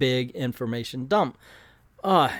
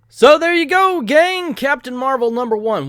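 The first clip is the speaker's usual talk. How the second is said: high, loud announcer flourish; excited sign-off; 11 semitones higher, 8 dB louder than usual. Real, not acted. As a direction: wrap it up with a big flourish.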